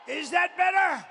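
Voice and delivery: testing the mic with a loud call; mic check shout. Shown as a demonstration. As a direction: yell it to the back rows.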